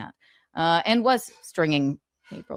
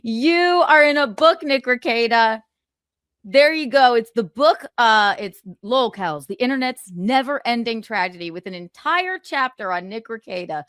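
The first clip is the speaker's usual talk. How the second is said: taunting; 4 semitones higher, 5 dB louder than usual. Real, not acted. Taunting Rekieta about the book.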